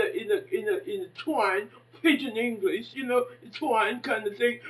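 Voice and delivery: ordinary delivery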